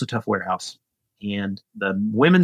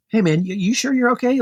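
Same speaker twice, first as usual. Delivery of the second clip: higher-pitched, tentative check-in; retold concerned check; 11 semitones higher than usual. Passing cyclist to dropped rider on the curb, retold concerned check. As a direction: re-enact checking on someone, voice lifted high.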